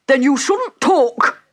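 high, loud, slow rebuke; rude Hatter. A narrator's reading. The Hatter silencing Alice, rude. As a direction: cut in loudly and lean on each word.

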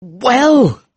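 high, impatient prompt. Acted. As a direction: press for an answer on a high pitch. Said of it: "I said impatiently".

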